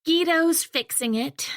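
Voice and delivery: exasperated sighing relay. Acted. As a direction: pass it on wearily with a sigh. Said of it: "I say with a sigh".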